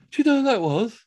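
high, put-on amazed question; mock astonishment; awestruck disbelief. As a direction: imitate stunned onlookers. Stunned floor managers ask him.

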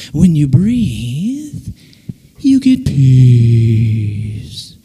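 very slow, drawn out, sinking low; breathing exercise calm. Acted. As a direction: guide the breath with long stretched words. Calming breathing-app style demo.

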